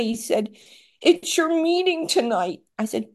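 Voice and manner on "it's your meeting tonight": high, strained imitation of an ailing husband; effortful, high-pitched mimicry; imitating labored speech. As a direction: voice the husband straining to get words out. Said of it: "he could hardly talk".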